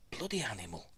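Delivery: soft, exasperated grumble under the breath; quiet grumble. Real, not acted. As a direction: grumble to yourself after a scare. Grumbling after the cat's scare.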